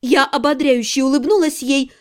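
plain reading voice

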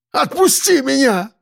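very high frantic yell; орёт. A narrator's reading. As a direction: scream to be let go. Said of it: "заорал он". (истошный вопль)

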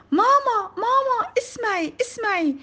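put-on very high, loud child's call; excited scream. A narrator's reading. Child calling mother to hear new letter, excited scream. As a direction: call out urgently at a very high pitch. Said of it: "فصرخت بأعلى صوتي".

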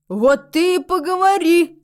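high, loud, slow delight; gleeful exclamation. A narrator's reading. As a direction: seize on it with loud glee. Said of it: "обрадовался бородач".

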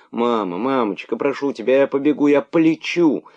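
put-on high, urgent begging child; high pleading plea, rising in urgency. A narrator's reading. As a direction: beg the mother to let her go. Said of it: "умоляла мартышка-дочка свою мать".

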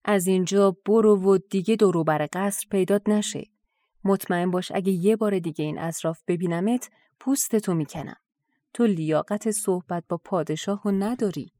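usual speaking voice

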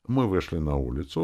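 usual speaking voice

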